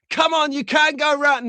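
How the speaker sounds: high, pleading coax; mock-urge the car round the bend; a playful plea to a slot car. Urging the car round a corner.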